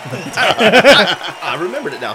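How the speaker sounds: high laugh breaking through. Real, not acted. Friend laughing after remembering the joke. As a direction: laugh out the words.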